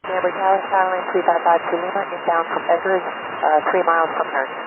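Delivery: tinny radio transmission; over a cockpit radio; pilot cockpit headset. Real, not acted. Pilot calling tower.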